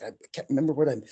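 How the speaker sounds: fast, mock flustered complaint; frustrated and angry. Imitating the frustrated drummer who lost his beat, angry. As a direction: blurt it out like someone mildly fed up. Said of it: "he was so frustrated and angry".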